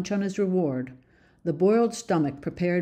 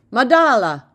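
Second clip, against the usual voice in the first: loud call across the yard, falling; 6 semitones higher, 10 dB louder than usual. A narrator's reading. Old man calling the toddler over, loud. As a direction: call Madala out loud to the boy. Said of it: "Reserati called out".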